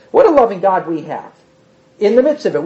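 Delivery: ordinary talk, nothing marked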